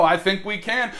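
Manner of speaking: normal talking voice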